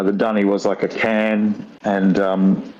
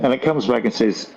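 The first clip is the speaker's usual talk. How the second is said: ordinary delivery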